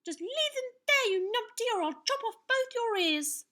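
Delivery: put-on very high, loud boyish shout. A narrator's reading. Young knight warns the giant off, shouting. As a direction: yell the warning over a cheering crowd. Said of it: "shouted through the cheers".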